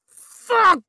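high, loud gasp of pain; gasped curse. A narrator's reading. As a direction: gasp it out in agony. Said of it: "she gasped".

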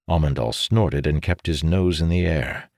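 usual speaking voice

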